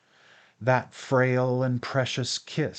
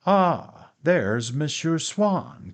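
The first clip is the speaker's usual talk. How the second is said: raised, louder exclamation, drawn out; crying out; 4 semitones higher, 4 dB louder, slower than usual. A narrator's reading. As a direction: call it out high and slow, greeting an arrival. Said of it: "cried my father".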